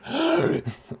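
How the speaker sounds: high startled gasp; sharp waking gasp. Retold or imitated. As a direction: gasp as if jolted awake. Actors suddenly waking up.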